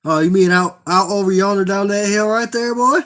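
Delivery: teasing Kentucky hillbilly impression, rising as it goes; mock Kentucky accent. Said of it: "The Kentucky accent?".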